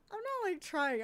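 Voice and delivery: high, loud insistence; emphatic protest. Real, not acted. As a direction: push back hard, voice jumping up. Defending herself, voice jumps up.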